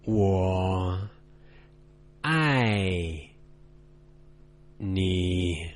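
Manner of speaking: "我" low, "爱" falling, "你." low; 我 爱 你. said low-falling-low in careful Mandarin tones. Shown as a demonstration. Teacher modelling "I love you" in Mandarin tones for learners. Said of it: "Try after me".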